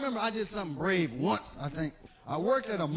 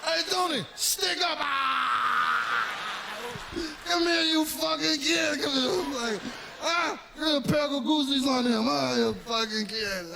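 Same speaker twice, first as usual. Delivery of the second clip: loud, high amused mobster yelling; laughing mobster; 4 semitones higher, 4 dB louder than usual. Acted. Mobster shouts to Tony mocking the kid's stickup, loud and amused. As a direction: hoot at the kid like an amused wiseguy.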